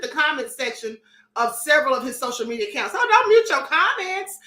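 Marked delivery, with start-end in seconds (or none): 3.0-4.4 louder mocking jab. Real, not acted. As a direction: tease him about hiding. Mocking [name] muting comments.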